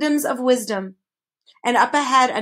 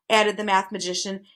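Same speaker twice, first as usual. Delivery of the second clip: usual speaking voice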